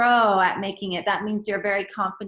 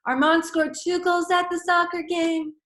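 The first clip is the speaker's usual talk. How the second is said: pitched way up with excitement; excited; 9 semitones higher, 4 dB louder than usual. Shown as a demonstration. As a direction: sound thrilled about the goals. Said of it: "say that with excitement".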